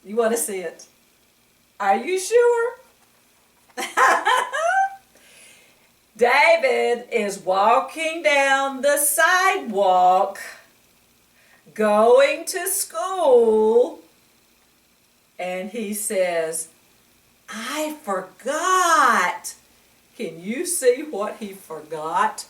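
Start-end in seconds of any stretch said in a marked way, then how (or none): 3.8-5.0 a high, playful giggle; light teasing laugh. Real, not acted. Teasing about the picture. 17.5-19.5 put-on slow, high, sheepish little boy; a boy admitting he forgot his pants; sheepish child. David's excuse voiced.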